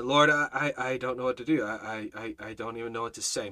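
soft, stammering, at a loss; low helpless murmur to God; retold speechless panic. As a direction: stumble quietly over each "I". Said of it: "My brain shut down. I couldn't think".

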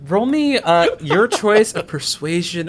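high, loud playful begging; pleading. Real, not acted. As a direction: beg for the roll. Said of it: "GM playfully begging".

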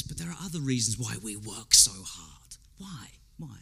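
confiding stage whisper. Acted. Preacher letting the congregation in on a secret, whispered. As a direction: lean in and breathe it to them.

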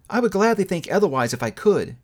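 a hint of a hurt young woman letting go; Belle, lightly voiced. A narrator's reading. Young woman to her changed lover, lightly voiced and sorrowful.

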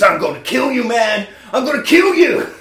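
loud, rising hammed-up yell; mock furious threat. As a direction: act out a raging threat, climbing to a shout. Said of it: "he stands up and he goes".

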